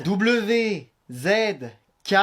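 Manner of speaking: one letter at a time, with pauses; slow dictation. Acted. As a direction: spell it out slowly for someone writing. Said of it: "moins vite". (dictée lente, lettre par lettre)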